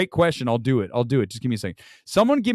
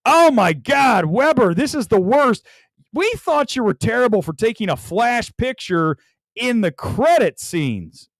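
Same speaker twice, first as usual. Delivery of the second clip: mock outrage; 7 semitones higher than usual. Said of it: "people are gonna throw shit".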